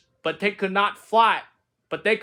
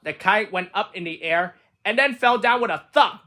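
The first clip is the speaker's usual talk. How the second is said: ordinary delivery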